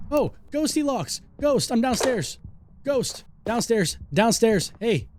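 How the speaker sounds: high, slow call to the ghost; yelling across the room. Real, not acted. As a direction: call up to it from downstairs. Calling the ghost downstairs.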